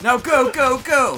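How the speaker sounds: urging shout. Acted. Commander yelling at a soldier to run, loud mock shout. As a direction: drive him out with a yell.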